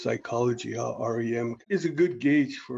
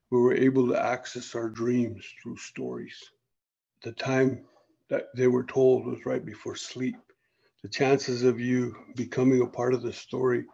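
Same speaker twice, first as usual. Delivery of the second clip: usual speaking voice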